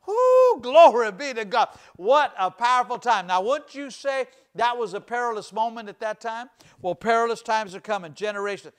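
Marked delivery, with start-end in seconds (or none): 0.1-3.3 joyful whoop. Real, not acted. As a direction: erupt with joy to God. Preacher bursts into praise.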